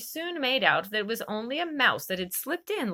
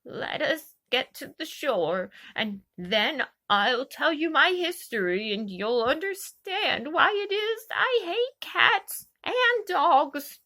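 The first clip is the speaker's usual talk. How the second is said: low trembling voice; 6 semitones higher, slower than usual. Acted. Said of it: "in a low, trembling voice".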